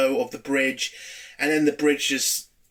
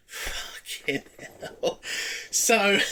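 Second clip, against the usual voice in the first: breathy whispered swear, slow; a slow whispered curse to yourself; muttered curse; 5 semitones higher, 3 dB quieter, much slower than usual. Real, not acted. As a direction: let it slip under your breath. Muttered aside at shocking scene.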